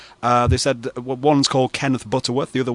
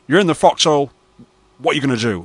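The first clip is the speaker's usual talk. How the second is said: catchphrase imitation, higher and louder, pause midway; Kenneth Butterworth; 4 semitones higher, 4 dB louder than usual. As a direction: punch the first half, pause, deliver the question. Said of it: "It's more like, you know".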